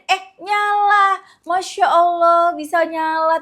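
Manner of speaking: high, slow amazed gasp of Masya Allah; stretched, astonished exclamation; delighted surprise. Retold or imitated. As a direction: marvel as the TV springs to life. TV suddenly turns on.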